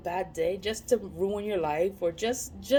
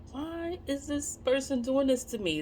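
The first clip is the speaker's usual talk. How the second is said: puzzled inner question; 6 semitones higher than usual. Retold or imitated. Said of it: "And it's like".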